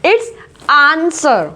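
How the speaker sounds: very slow answer, no W; drawn-out answer with no W sound; silent W. Shown as a demonstration. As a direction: answer as आंसर. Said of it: "W का sound कहीं से कहीं तक नहीं है".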